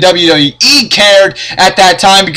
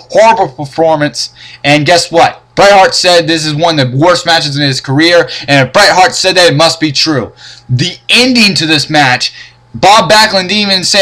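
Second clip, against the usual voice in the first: ordinary talk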